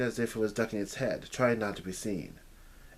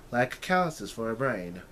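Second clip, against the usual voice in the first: ordinary delivery, nothing marked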